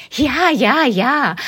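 put-on high, eager children's yes; children calling. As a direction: kids answering keenly. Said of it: "rufen einige Kinderstimmen". (eifrige Kinderstimmen)

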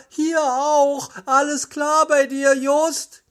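high-pitched call into the distance. A narrator's reading. Peter checking on Justus in the tunnel, high calling. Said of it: "rief Peter".

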